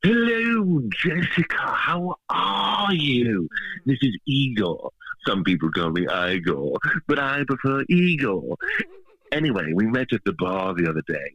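Igor voice. Acted. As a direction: play a creepy castle hunchback. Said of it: "I totally can talk normal, too".

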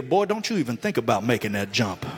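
low, lightly voiced stern father; stern dad warning. Father warning his son at the dock, low mock stern. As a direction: warn the boy off like a dad, laughing a little.

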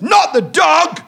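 high, loud panto yell; panto shout. Retold or imitated. Jester's panto line shouted.

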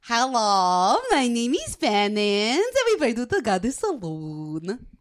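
low, slow swooping devotee voice; Penance. Acted. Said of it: "I could do Sarah's, uh, character".

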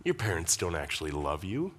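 understated devil's insinuation; the devil's lie. Acted. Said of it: "He gives you really good lies".

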